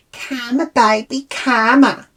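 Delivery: high, singled-out taunting words; mocking jeer. Mimics women jeering at her.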